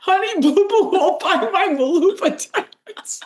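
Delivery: very high, slow, laughing squeak; silly high voice. Pretend. Joking her shoes are too posh, high laughing squeak. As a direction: giggle the whole line out in a high cartoon squeak. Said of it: "Now we're just getting overly silly".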